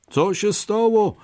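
high-pitched called question; calling out. A narrator's reading. Third rabbit calls to the two runners, calling out. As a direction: call it out high. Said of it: "zawołał".

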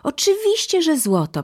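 put-on high, excited children's reply; eager confirmation. A narrator's reading. Children confirm the gold to mother, high and eager. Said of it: "potwierdziły dzieci".

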